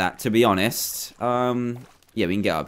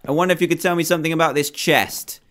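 usual speaking voice

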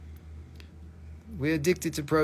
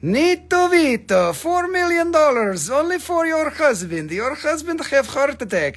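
playful sales pitch in a higher put-on voice; put-on seller voice; 12 semitones higher, 6 dB louder than usual. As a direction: ham up a pushy gem saleswoman.

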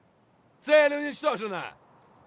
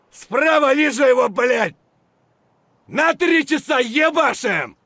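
loud, swearing battle shout; angry battle yell; 6 dB louder than usual. Acted. Soldier ordering fire at enemy, furious shout. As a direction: scream over the gunfire.

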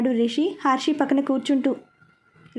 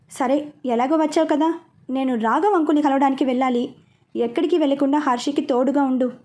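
plain reading voice

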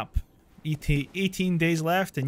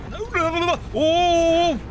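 high, frantic no no no into a yell; real panic as he attacks; panicked cry; 13 semitones higher, 6 dB louder, slower than usual. Real, not acted. Monster attacking in game.

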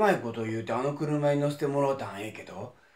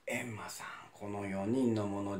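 whispering; 6 semitones lower, 7 dB quieter than usual. Acted. Demon asks Enma quietly.

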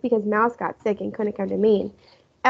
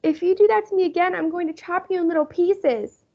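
put-on angry child; 7 semitones higher than usual. A narrator's reading. Fudge kicking and threatening the rollaway bed. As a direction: threaten the bed like a cross little boy. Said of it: "Fudge cried, kicking".

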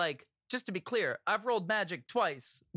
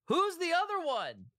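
high, raised call into the mist; yelling out; 8 semitones higher than usual. Acted. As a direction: holler it to someone hidden in the mist. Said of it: "yells out into the mist".

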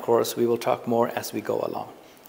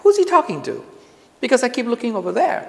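confused audience; 9 semitones higher than usual. Retold or imitated. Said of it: "You'll be like".

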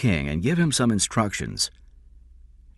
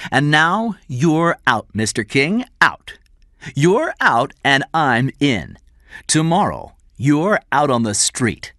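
slow, loud, high gloat; gloating triumph; 6 semitones higher, 4 dB louder than usual. A narrator's reading. Cameron tells Mister King he's out, gloating. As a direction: drive each word home triumphantly.

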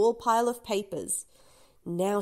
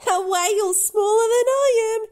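loud whisper; 11 semitones higher, 9 dB louder than usual. Acted. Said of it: "he whispers a little too loudly".

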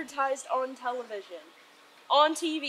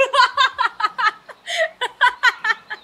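high loud laughing fit; shrieking laugh; 10 semitones higher, 10 dB louder than usual. Real, not acted. Soaked with water.

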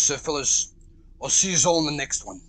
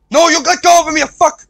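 angry shout; 10 semitones higher, 13 dB louder than usual. Real, not acted. Enemy grabbing Freddy.

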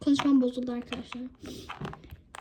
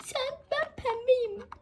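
put-on high, slow little girl voice; drawn-out childish voice for the toy daughter; a small doll bragging slowly; 9 semitones higher, slower than usual. Child toy character speaking slowly.

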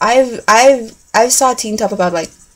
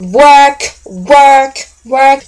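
loud, higher, punchy repeated shouts; mock fan shout; 5 semitones higher, 7 dB louder, much slower than usual. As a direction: belt it out like an excited fan. Acting out picture-taking at concert.

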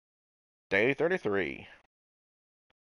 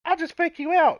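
high taunting voice, louder; mock opponent voice; 6 dB louder than usual. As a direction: play the smug rival. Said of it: "he's gonna be all like".

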